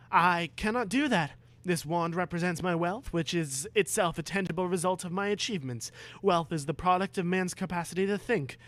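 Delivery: lightly voiced brave Harry. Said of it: "Harry said bravely".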